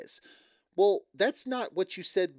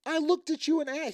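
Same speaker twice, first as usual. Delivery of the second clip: much higher, flustered protest; mock defensive boyfriend; 14 semitones higher than usual. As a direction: squeak it like he's caught off guard. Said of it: "He's like".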